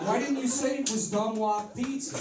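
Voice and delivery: high, loud thrilled voice; loud happy customers; delighted customers. Acted. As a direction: gush like won-over fans. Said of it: "And people are like".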